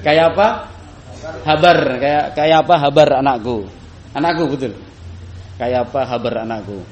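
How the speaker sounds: Banjar-flavoured question, mildly put on; Banjar accent. Shown as a demonstration. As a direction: ask it the Banjar way, a touch loud. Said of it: "Bahasa Banjarnya".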